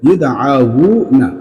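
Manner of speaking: each syllable stretched very slowly; slow drawn-out demo. Shown as a demonstration. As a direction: spell out ইউদ আউনা slowly for the pupils. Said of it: "এই যে, এই যে দেখো".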